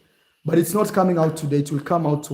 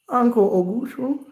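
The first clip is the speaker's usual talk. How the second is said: higher-pitched mock American accent; North American accent; 7 semitones higher than usual. Imagined visitor from America addressing the uncle, mock American accent. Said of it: "with your accent, with your Canadian accent".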